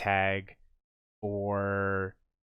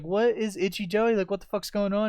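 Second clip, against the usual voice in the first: baffled; 10 semitones higher than usual. Retold or imitated. Retold confusion.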